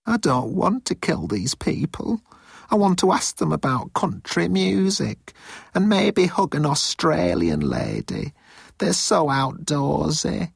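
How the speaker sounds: high, thin-pitched pleading voice; plaintive underling. Acted. Moan wishes he could spare the Earthlings, high and plaintive.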